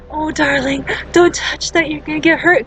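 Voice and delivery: gently coddling the kid, lightly mocked; mock soft parent. Mocking a soft parent warning a kid off the stove. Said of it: "Kids don't listen to that soft, budging voice".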